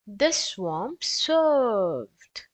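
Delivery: plain reading voice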